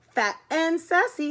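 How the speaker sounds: understated storybook sob; mild acted sob. A narrator's reading. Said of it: "sobbed Murray".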